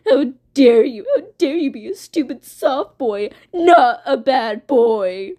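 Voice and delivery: loud, put-on petulant whine; indignant mock crybaby outburst; Blood Moon, pissy crybaby. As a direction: throw a hammed-up tantrum. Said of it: "Blood Moon's a little pissy crybaby".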